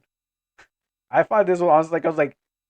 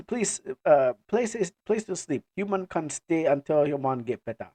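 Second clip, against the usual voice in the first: put-on African-accented clan member making peace; apologetic words in an African accent. Mana offering human a bed, African accent. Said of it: "African accent".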